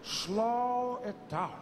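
long, drawn-out words; slowed right down. Acted. Mandela coaching hasty Obama, very slow delivery. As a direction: stretch each word to rein him in.

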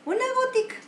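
"Una gotica" squeaked high, stressing a tiny drop; high emphatic repeat. Shown as a demonstration.

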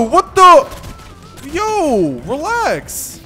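high, alarmed outburst at a sudden attack; startled, voice jumping up high; startled yelp. Real, not acted. Gamer to an attacking shark, startled.

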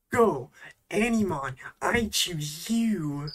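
loud, high battle call, drawn-out ending; mock Pokemon trainer. Joking that the word sounds like a Pokemon, mock trainer call. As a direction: send out a Pokemon with a hammed-up shout. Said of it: "sounds like a Pokemon".

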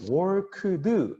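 "worked" split into work-eu-deu, every consonant given its own vowel; over-articulated syllables. Shown as a demonstration. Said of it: "이렇게 발음하면 되게 클리어할 것 같은데".

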